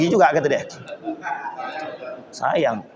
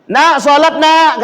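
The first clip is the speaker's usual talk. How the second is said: put-on calling to child; 8 semitones higher, 6 dB louder, much slower than usual. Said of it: "kata dia".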